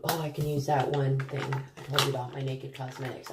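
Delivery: ordinary talk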